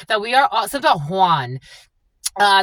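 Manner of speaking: normal talking voice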